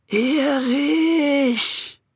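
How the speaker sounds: high, long ghostly call. Acted. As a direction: stretch the name out eerily. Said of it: "ein wenig lauter".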